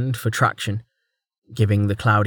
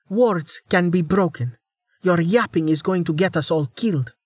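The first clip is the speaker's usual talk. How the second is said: heated; 7 semitones higher than usual. Acted. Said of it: "just as hotly".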